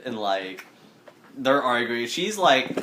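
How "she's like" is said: ordinary delivery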